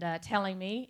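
usual speaking voice

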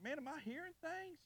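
high-pitched puzzled question; acted bewilderment. Preacher recalling his thought after the thunder, acting baffled.

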